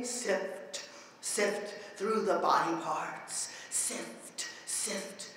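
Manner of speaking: very soft, low, near-whispered; hushed, low poetic incantation; hushed refrain. Acted. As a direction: let each sift fade to a breath. Hushed refrain in performed poem.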